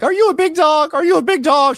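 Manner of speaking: sing-song challenge; mock Kelly taunt. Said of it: "So Kelly's like".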